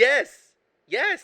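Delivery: loud, high burst of yes; emphatic agreement. Real, not acted. Host confirming co-host's correct guess, loud and excited. Co-host finally recalls the name.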